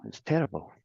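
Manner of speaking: normal talking voice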